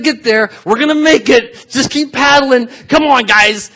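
loud, high, frantic pep talk; rally the rowers, high and loud; straining disciples. Acted. Disciples in the boat urging each other on, straining.

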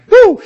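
high loud startled woo; startled whoop. Acted. Shepherds startled by an angel, loud whoop. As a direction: yelp like something jumped out.

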